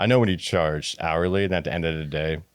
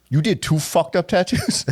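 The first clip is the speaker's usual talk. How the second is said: high, slow incredulous question; can't believe what you heard; retold stunned boss; mock disbelief; 9 semitones higher, slower than usual. Retelling own disbelief.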